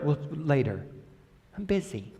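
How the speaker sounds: very soft, offhand dismissal; mock brush-off. Mock God putting off a prayer, very soft brush-off. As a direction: toss it away under the voice.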